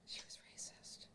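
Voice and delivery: unvoiced whispered aside; whispering. Real, not acted. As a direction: whisper it like a secret.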